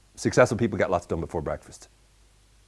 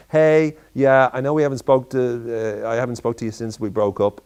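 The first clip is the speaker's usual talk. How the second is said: bright, loud hello to an ex that trails off; breezy fake-casual greeting to an ex; calling an ex out of the blue, too cheerfully; mock cheery phone call; 3 semitones higher, 6 dB louder than usual. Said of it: "call people randomly going".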